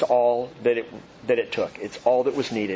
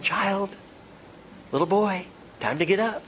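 put-on waking a child; 4 semitones higher, 3 dB quieter than usual. Shown as a demonstration. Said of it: "I might say that to Naomi".

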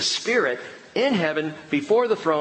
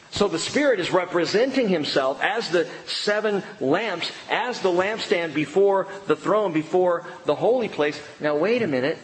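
ordinary talk, nothing marked

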